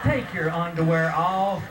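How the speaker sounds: slow, drawn-out doctor's order; insistent repeated order. Retold or imitated. Doctor cutting off the boy's question, slow and insistent.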